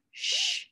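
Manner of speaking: SH as a long hushing consonant; isolated sh sound. Shown as a demonstration. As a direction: hold just the "sh", with no vowel.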